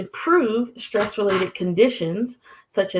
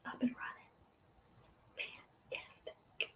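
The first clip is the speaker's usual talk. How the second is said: barely audible, voiceless whisper; whisper to self; 22 dB quieter, faster than usual. Real, not acted. Host checking her stream setup, whispering to herself. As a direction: whisper it under your breath. Murmuring to herself while setting up.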